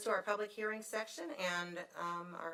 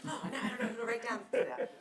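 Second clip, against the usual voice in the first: laughing; 4 semitones higher, faster than usual. Real, not acted. Chair laughs over motion mix-up.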